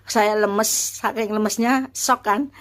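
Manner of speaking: normal talking voice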